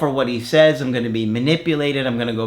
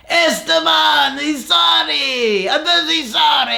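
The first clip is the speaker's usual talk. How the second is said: much higher, slow shouted apology in a goofy cartoon voice; cartoon man begging; 13 semitones higher, slower than usual. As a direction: wail the apology like a desperate cartoon guy. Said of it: "I did the voice".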